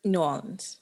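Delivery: New Orleans said "nu Ahlenz", no R, Orleans run together as one word; local pronunciation. Shown as a demonstration. Asked how locals say it, she gives New Orleans like a native. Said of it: "how do you say it from th-, if you're from there".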